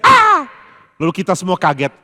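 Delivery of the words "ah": shrill falsetto shriek, much higher and louder; soprano scream. Retold or imitated. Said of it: "Dia bisa teriak".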